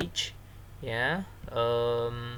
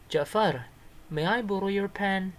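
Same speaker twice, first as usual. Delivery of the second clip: higher-pitched voice asking to borrow; acted dialogue request; 7 semitones higher, 4 dB louder than usual. Shown as a demonstration. Pupil asking Ja'far to borrow a pen, high acted request. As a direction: read the character's request high and lifted. Model reading of dialogue character.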